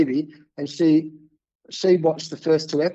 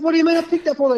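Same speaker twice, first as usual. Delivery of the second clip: stunned disbelief; 13 semitones higher, much faster than usual. Retold or imitated. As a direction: squeak it out in shock. Stunned by producer's point.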